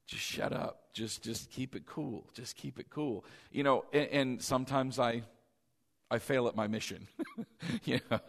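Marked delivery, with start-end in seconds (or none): 0.1-3.2 hushed, under-the-breath self-talk; whispering himself down; inner self-talk. Retold or imitated. Preacher muttering to himself to stay calm. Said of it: "I can just hear my voice".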